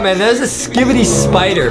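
usual speaking voice

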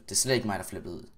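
ordinary talk

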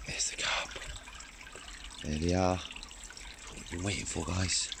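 whispering at the pond, barely voiced; hushed whisper so the carp don't spook. Real, not acted. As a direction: keep it hushed so the fish stay calm. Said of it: "trying to be a bit quiet".

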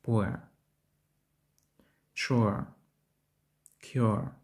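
"Poor", "Sure", "Cure" with U gliding into short E; дифтонг уе або юе. Shown as a demonstration. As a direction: glide from U or Yu to a neutral end in "Poor", "Sure", "Cure". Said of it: "Вимова починається з голосного ю". ("Poor", "Sure", "Cure" з коротким Е після У)

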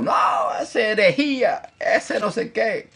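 high, nasal-free mock outrage; mocking accuser. Preacher mimicking someone calling his words heresy, high mock outrage. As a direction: parody a critic crying heresy. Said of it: "Y luego".

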